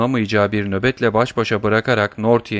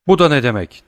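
raised, higher startled question; a touch of alarm, louder; horrified exclamation; 4 semitones higher, 5 dB louder than usual. A narrator's reading. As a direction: cry out in dismay. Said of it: "diye haykırdı dehşete ve büyük bir üzüntüye kapılan".

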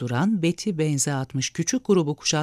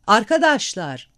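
"Arkadaşlar!" called out louder and higher, falling at the end; shouted; 5 semitones higher, 5 dB louder than usual. A narrator's reading. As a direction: cry out "Arkadaşlar!" over the noise. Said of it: "diye yeniden bağırdı Henry".

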